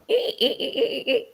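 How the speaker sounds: high squeak through a laugh; laughing imitation of a squeaky toy; toy bunny squeak.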